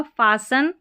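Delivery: फासन, the T left silent. Shown as a demonstration. Teacher repeats the word after explaining the rule, फासन with silent T. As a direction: say फासन without any T. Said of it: "ट उच्चारण नहीं होगा".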